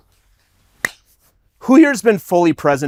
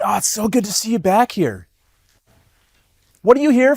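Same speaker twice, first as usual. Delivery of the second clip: boss's question; 7 semitones higher than usual. Retold or imitated. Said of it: "And he said".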